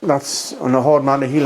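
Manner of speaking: ordinary talk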